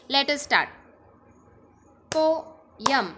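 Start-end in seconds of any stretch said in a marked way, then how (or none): 2.2-3.1 poem in two clapping beats; split into syllables. Shown as a demonstration. Teacher leading a clapping drill, poem split in two. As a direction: leave a clear gap inside poem. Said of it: "partition में इसे बोलना है".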